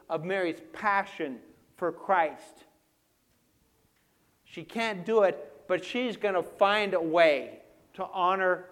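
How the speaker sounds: loud, high, forceful pulpit delivery; impassioned preaching. Real, not acted. Preacher stressing Mary's passion for Christ, loud and fervent. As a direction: drive each phrase home at full voice.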